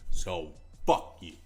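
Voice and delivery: normal talking voice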